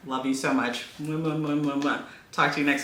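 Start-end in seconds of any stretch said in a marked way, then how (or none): 1.0-2.0 between kisses. Real, not acted. Blowing kisses goodbye to viewers.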